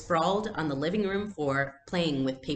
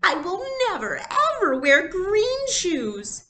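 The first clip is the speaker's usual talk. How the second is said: much higher, louder, slow tearful protest; wailing refusal; 6 dB louder than usual. Acted. Little girl to the family, wailing refusal. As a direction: refuse through tears like a child.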